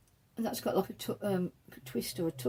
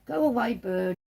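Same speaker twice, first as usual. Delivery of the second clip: raised, louder shooing call; 4 semitones higher, 6 dB louder than usual. Real, not acted. Knitter breaks off her video to shoo a bird, calling out.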